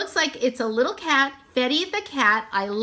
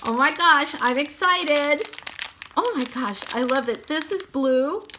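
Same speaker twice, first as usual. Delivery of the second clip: ordinary talk, nothing marked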